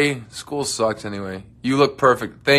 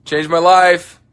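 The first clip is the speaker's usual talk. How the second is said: usual speaking voice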